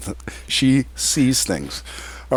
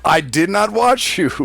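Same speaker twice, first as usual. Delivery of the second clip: indignant denial; 7 semitones higher than usual. Real, not acted. Defending himself against a teasing accusation.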